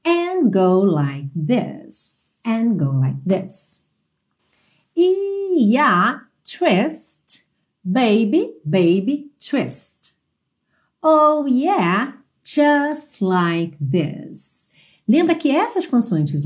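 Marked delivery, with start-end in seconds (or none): 0.0-3.8 slowly drawn-out English; slow model line. Shown as a demonstration. Teacher modelling a lyric for students, slow and clear. 5.0-14.7 very slow, drawn-out words; slow model lyrics. Shown as a demonstration. Teacher modelling song lyrics for students, very slow and drawn out.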